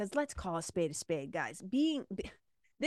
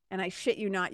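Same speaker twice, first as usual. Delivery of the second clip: ordinary delivery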